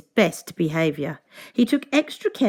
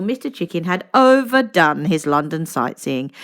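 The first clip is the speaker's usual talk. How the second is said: plain reading voice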